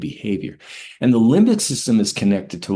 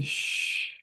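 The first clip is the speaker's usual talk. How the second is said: soft drawn-out shushing sound; long shush; 10 dB quieter than usual. Shown as a demonstration. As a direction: shush like soothing a baby. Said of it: "making a shush sound".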